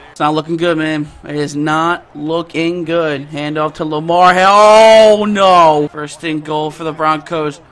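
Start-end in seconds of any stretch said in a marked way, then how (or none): 4.4-5.9 loud, drawn-out "oh no"; dismayed cry. Real, not acted. Gamer watching Lamar's run, loud dismay. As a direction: bellow it long in dismay. Opponent breaking through.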